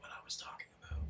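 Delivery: unvoiced quick whisper. Real, not acted. Telling his cohost he remembered his point, whispered. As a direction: murmur it fast as an aside. Aside to friend.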